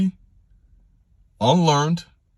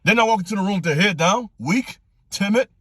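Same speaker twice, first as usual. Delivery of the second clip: high-pitched passionate exhortation; impassioned; 7 semitones higher than usual. Real, not acted. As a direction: let the conviction lift your voice high. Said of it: "This is passion".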